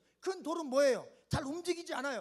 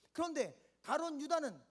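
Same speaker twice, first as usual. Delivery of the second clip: ordinary talk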